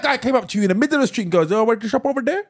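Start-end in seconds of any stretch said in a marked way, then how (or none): 1.5-2.4 imitated Indian English; mock Indian accent. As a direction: ask it in a put-on Indian accent. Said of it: "an Indian twang".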